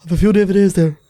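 imitated Irish traveller DVD seller; hammed-up Irish traveller patter; Irish traveller accent. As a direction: put on a traveller accent, playing a door-to-door seller. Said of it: "my traveller accent comes from a fella".

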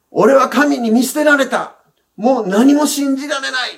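mild mock lament of a forsaken man; voicing despair. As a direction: let a touch of hopeless despair lift the voice. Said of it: "絶望の心に落ちた".

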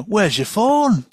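high, drawn-out mock Mancunian accent; loud, stretched-out Manchester accent put on for a quote; Jackie Lane. Podcaster mimicking an actress's regional accent, exaggerated Mancunian. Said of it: "get Jackie Lane to come in and she go".

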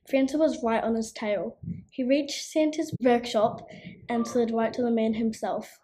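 usual speaking voice

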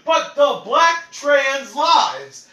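slow, high, loud mock whine; mocking drawl. Mocking Jeffree's dodge, slow whiny drawl. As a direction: drag out the slogan mockingly. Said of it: "My man was like".

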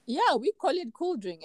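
ordinary delivery

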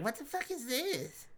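high mocking imitation of his wife; mocking wife's voice. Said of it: "you were like".

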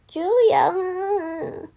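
quiet mocking. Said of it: "say my name quietly".

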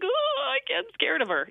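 high squeaky mock fright; mock scared. Caller seeing coworker, mock squeal of fear. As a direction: play the little frightened voice. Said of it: "and I'm like".

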